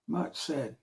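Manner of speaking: ordinary delivery, nothing marked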